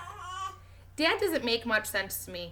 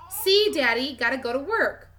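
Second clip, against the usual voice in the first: put-on toddler voice; 4 semitones higher, 6 dB louder than usual. Toddler speaks to Daddy.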